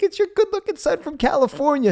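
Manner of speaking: high, loud and playful; cheery greeting. Retold or imitated. Son playfully reintroducing himself to his dad. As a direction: sell yourself to dad with a grin.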